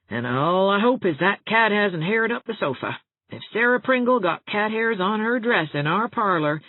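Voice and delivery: worried rush of dread; anxious housekeeper. A narrator's reading. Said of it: "Rebecca worries".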